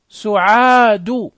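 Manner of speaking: سعاد slowly stretched, long aa and final u; drawn-out modelling. Shown as a demonstration. Teacher practising damma with pupils, drawn-out modelling. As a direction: hold the long vowel in سعاد.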